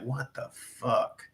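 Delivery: slow, soft what the fuck in an altered voice; murmuring disbelief at the Russian claims; stunned disbelief. Retold or imitated. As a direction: let it hang out slowly, dumbfounded. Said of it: "I'm just like".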